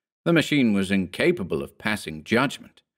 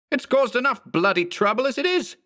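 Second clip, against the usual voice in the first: high-pitched exasperated outburst; heated, high character voice in a quarrel; angry complaint. Acted. Acted quarrel between Mark and clone.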